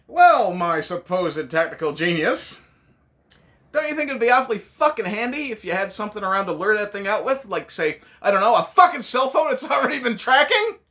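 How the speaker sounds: high, loud mocking scorn; sarcastic rant. Reviewer mocking Sarah's tactics, scornful. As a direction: sneer it at the so-called genius.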